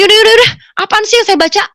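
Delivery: high, loud, fed-up giving in; retold cry of impatience; exasperated outburst. Gives in to the voice.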